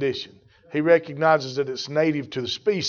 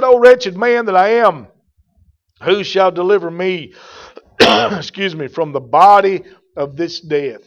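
louder, pleading scripture cry; preached scripture; 3 semitones higher, 9 dB louder than usual. Acted. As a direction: proclaim the verse to the church. Reads the verse with force.

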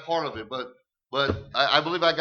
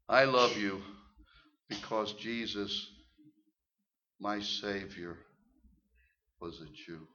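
softer, gentle and unhurried; soft tender explanation; 6 semitones lower, 5 dB quieter, slower than usual. Retold or imitated. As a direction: say it quietly, like a confession of faith. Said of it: "He says".

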